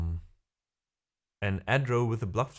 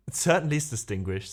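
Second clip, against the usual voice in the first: a light, higher-pitched mock-aristocrat voice; mock posh lord; 6 semitones higher than usual. Streamer mocking a posh British lord for chat, lightly hammed. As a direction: put on a snooty lord for a joke. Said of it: "says Lord Earl Grey".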